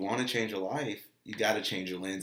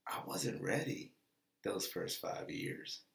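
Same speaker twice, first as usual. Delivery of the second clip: whisper; 3 semitones lower, 8 dB quieter than usual. Real, not acted. Confessing his shortcoming.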